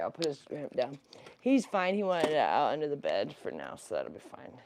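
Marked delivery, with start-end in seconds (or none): none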